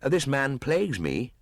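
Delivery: put-on high, cool boyish voice; a defiant jungle boy mocking the hunter; scornful boy, pitched high; young Mowgli.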